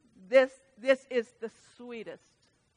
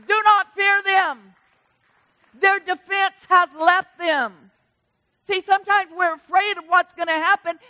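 preaching at full force; 7 semitones higher, 9 dB louder than usual. Acted. Preacher rallying the church against fear, loud and high. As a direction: shout it out like the spirit has hold of you.

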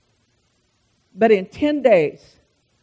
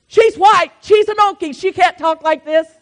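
mocking shocked locals; 6 semitones higher, 5 dB louder than usual. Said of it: "They're like".

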